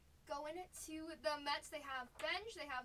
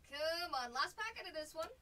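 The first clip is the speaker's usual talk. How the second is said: urging; 3 semitones higher, 8 dB louder than usual. Real, not acted. Urging the last pack.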